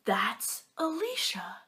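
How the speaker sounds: slow hushed whisper; awed whisper. Retold or imitated. Recognizing the famous girl Alicia, slow awed whisper. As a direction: breathe the name in awe.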